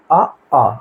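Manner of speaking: आ said twice, open and clear from the throat; vowel demo. Shown as a demonstration. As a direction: demonstrate आ from the throat. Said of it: "हम उच्चारण करके भी देख सकते हैं". (आ को कंठ से खुलकर बोलना)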